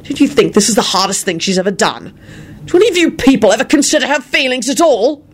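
heated tirade climbing in pitch; enraged. A narrator's reading. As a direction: berate them all, building to a high demand. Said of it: "Lupin sprang up in a rage".

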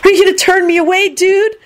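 high, pleading squeal; lovesick shriek. A narrator's reading. Lovesick Kenny keeps shrieking.

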